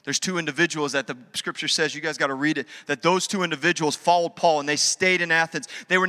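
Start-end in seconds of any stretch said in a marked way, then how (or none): none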